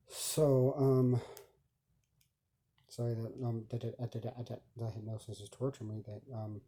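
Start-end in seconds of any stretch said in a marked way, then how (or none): 2.9-6.6 stuck repeating the, the, the; stuttering. Real, not acted. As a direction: stammer on each restart. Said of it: "I'm only, like, stuttering".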